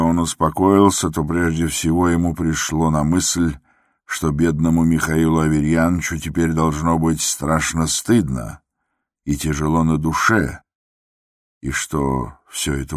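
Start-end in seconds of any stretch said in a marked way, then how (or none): none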